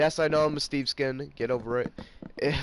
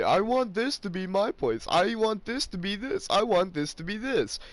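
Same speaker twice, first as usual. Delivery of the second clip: higher mock whine of demands; mocking bossy friend; 7 semitones higher than usual. Vlogger mocking bossy Weston's demands, higher whine. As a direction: parody his bossiness, pitched up. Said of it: "and he's like".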